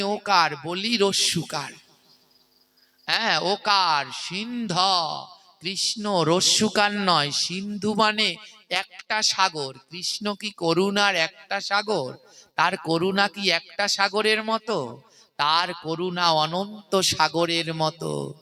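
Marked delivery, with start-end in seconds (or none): none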